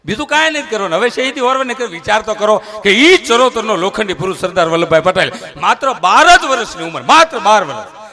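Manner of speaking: loud, high rousing speech to the crowd; fiery patriotic oration. Acted. Comedian stirring the crowd about Sardar, rousing oration. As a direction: declaim it like a stage orator.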